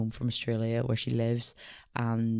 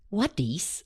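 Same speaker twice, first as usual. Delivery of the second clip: imitated toddler, higher pitch; little niece's accent; 6 semitones higher than usual. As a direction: ask like a curious toddler with an accent. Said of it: "she's a funny little accent".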